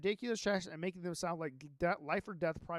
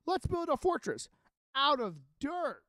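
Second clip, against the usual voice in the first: eager suggestion; 7 semitones higher, 5 dB louder than usual. Retold or imitated. Retelling the AI's eager prompts.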